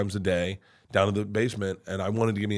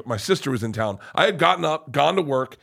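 usual speaking voice

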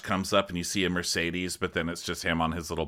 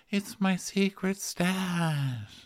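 dopey whisper-ish confession; 11 semitones higher, much slower than usual. Retold or imitated. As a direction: confess it slowly like a guilty kid. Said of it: "He's like".

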